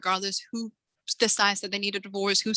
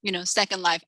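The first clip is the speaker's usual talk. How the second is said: ordinary talk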